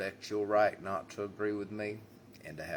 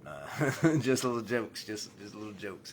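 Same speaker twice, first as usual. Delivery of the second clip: laughing; 3 semitones higher, 6 dB louder than usual. Real, not acted.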